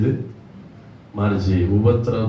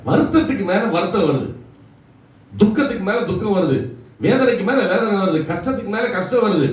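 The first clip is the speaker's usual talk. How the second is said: high-pitched, driving sermon delivery; preacher building a relentless list; impassioned preaching; 10 semitones higher than usual. Real, not acted. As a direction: pile up each phrase in a high, urgent preaching pitch. Preaches with mounting intensity.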